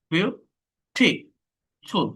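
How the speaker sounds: Beautiful in three slow, separate beats; split into syllables. Shown as a demonstration. Teacher showing three syllables, beautiful slowly split. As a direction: leave long gaps between the syllables of beautiful. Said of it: "তিনটা syllable আছে".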